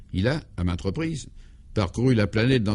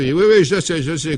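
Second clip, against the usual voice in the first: interrupting; 6 semitones higher, 6 dB louder than usual. Acted. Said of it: "coupa Clovis".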